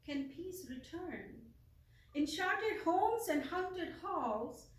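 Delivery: impassioned, declamatory poem reading with drawn-out words; measured poetic recital with long pauses and ringing emphasis. Acted. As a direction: recite it like a solemn lament, swelling on key phrases. Let me read my poem.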